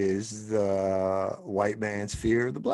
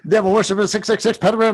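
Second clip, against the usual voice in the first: mock preacher; 9 semitones higher, 11 dB louder, faster than usual. Echoing the ranting preacher clip.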